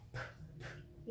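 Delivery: "p" as a soft voiceless puff; letter sound. Shown as a demonstration. Teacher gives children the P sound, isolated. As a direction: pop "p" alone, without a vowel. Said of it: "La P".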